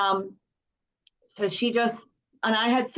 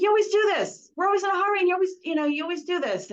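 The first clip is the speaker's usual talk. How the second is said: high, whiny voice of the daughter; a high imitation of a teen complaining; mimicking upset daughter; 10 semitones higher, faster than usual. Mother mimics upset daughter, high and whiny. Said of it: "She's like".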